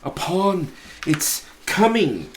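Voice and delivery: ordinary delivery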